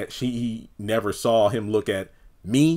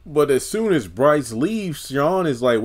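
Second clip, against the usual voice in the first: usual speaking voice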